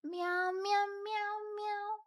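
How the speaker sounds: playful high kitty meows; cat meows. Pretend. Streamer meowing at chat, high playful cat voice. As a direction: meow like a cat to greet chat.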